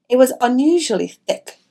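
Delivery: usual speaking voice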